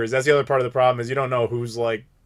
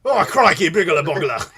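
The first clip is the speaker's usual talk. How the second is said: playful fake Australian gibberish with a laugh; mock Australian accent; 2 semitones higher than usual. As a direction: ham up an Australian saying "crikey". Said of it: "Australians just put whatever sentence together with random gibberish".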